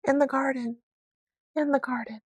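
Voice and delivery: put-on a high, far-off child's voice calling out; dream voice calling. A narrator's reading. A remembered voice calling him from the garden. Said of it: "the voice which called back to him".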